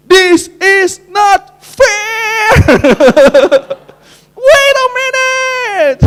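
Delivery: high, slow, stretched-out protest; mock scream. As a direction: wail it like a sore loser. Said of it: "was screaming".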